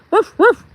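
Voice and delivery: high, sharp imitated woofs; high-pitched mock barking; dog bark.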